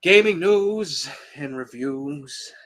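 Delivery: slow announcer segment intro. Acted. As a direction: announce it like a show host.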